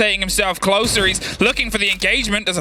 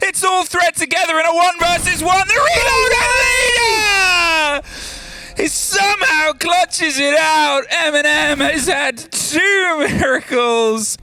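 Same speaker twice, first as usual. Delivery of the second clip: esports caster; 7 semitones higher, slower than usual. Real, not acted. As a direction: scream the clutch call. Caster calls a clutch.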